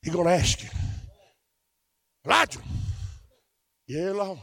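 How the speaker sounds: low, soft preacher's storytelling; soft, low sermon storytelling; hushed preaching. Acted. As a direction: draw them in with a quiet sermon voice. Quiet low preaching passage.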